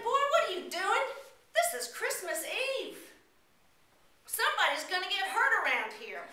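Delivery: high, loud fatherly scold; stern shopkeeper rebuke; old man scolding. Acted. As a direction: chide the boy firmly.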